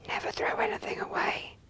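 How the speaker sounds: hushed, breathy secret whisper. Real, not acted. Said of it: "I don't know why I'm whispering".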